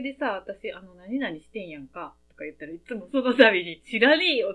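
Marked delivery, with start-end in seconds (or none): 3.8-4.5 loud, curt Tokyo-style 知らねえよ; mock-rough comeback; harsh Tokyo retort. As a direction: snap back like a rough Tokyo friend. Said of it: "すごい激しいツッコミが".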